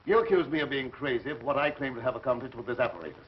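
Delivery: ordinary talk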